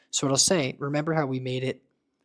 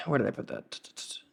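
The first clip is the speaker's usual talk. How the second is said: whispered aside; 5 dB quieter than usual. Real, not acted. Aside while searching.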